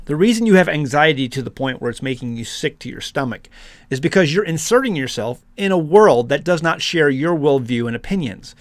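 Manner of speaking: usual speaking voice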